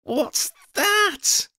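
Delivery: very high startled cry; startled high-pitched yelp of a question; cried out. A narrator's reading. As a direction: cry out in fright, pitch shooting up. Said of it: "Freddie cried".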